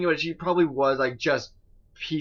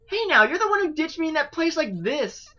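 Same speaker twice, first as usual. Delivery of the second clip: lightly voiced indignant boy; 12 semitones higher, 4 dB louder than usual.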